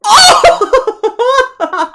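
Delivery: loud, high joyful squeal; excited shriek. Real, not acted. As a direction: squeal with delight at the roll. Natural twenty rolled.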